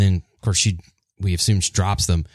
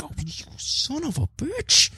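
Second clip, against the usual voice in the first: angry; 6 dB quieter than usual. Retold or imitated. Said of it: "just angry".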